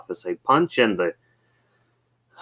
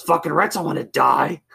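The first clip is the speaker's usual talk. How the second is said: higher-pitched grumpy comeback; cranky retort; 7 semitones higher than usual. Retold or imitated. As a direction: imitate a cranky guy doubling down. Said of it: "cranky. Like, whenever he".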